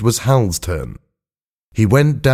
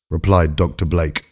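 usual speaking voice

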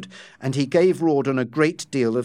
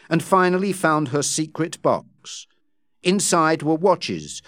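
usual speaking voice

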